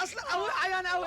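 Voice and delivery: whining plea. Acted. Boy begs with sick-father excuse.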